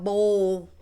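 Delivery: half-hearted game-call holler; mild mock yell. Shown as a demonstration. Explaining what players needn't yell, mildly mock-yelled. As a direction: raise the voice a little, as if yelling. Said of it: "you don't have to yell out".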